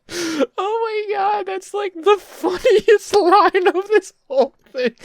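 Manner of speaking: high, teary laughter; laughing so hard it hurts; crying laughing. Real, not acted. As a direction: wheeze it through tears of laughter.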